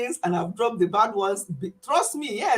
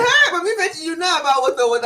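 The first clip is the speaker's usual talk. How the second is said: high, loud excited call; Maureen imitation; 7 semitones higher, 6 dB louder, faster than usual. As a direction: imitate a friend calling out, pitched up and loud. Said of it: "Maureen will be saying".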